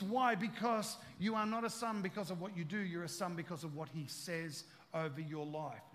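usual speaking voice

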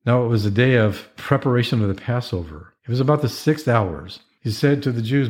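ordinary delivery

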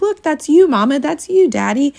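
put-on high, loud little-girl voice; imitating little daughter. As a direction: squeak it out like a proud four-year-old. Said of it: "She's like".

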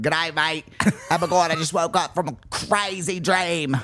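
badly faked Australian accent; terrible put-on accent. Waking from a coma, badly faked Australian accent. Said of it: "My accents this episode are terrible".

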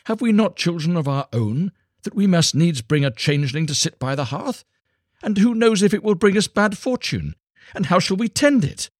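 high, fretful peasant wife; worried wife. A narrator's reading. Wife fretting to her husband about the changeling, high and anxious. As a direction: voice her fear of bad fortune, pleading.

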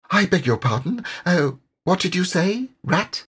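a small animal blinking awake and asking; puzzled Mole. A narrator's reading.

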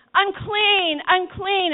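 high, loud warning cry; lepers calling out. Retold or imitated. Lepers calling out to the crowd, a high loud warning cry. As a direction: call it out so people keep away. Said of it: "When the lepers came around saying".

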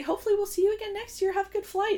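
high, quick social farewell; departing rich tourists. Retold or imitated. Podcaster voicing the tourists leaving the airport, high quick farewell.

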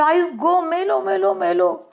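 high, drawn-out wail of panic; sudden cry out. A narrator's reading. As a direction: yelp for mother as you fall. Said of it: "मी एकदम ओरडलो".

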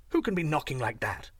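usual speaking voice

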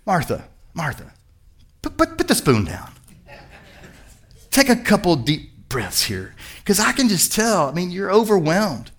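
soft, careful calming voice; calming Jesus. Acted. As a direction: talk her down quietly and gently. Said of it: "He's just making an observation here".

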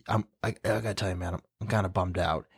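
soft, low, hurried admission to a buddy; quiet confession. Retold or imitated. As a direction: mumble it like it's hard to say. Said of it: "we're like".

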